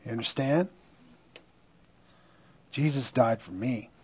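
low, soft, solemn confession; humbled preacher speaking softly, with long pauses; hushed reverence. Real, not acted. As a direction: let it drop low and quiet, deeply personal. Solemn personal confession in sermon.

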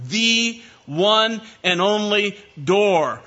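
slow, each word weighted; one and only, spelled out slowly; emphatic. Shown as a demonstration. Preacher stressing the one and only door. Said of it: "he used very emphatic language".

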